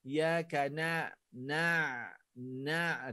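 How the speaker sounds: iyaka na- slow, voice pressed at the throat; pressed ain. Shown as a demonstration. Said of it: "Tekan suara kita". (tekan suara di kerongkong)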